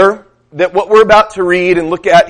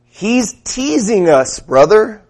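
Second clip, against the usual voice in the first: higher, slow drawn-out church-brother voice; what I heard; 4 semitones higher, slower than usual. Retold or imitated. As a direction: stretch it out like a fervent congregant. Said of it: "here's what I heard".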